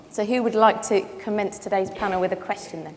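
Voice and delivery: usual speaking voice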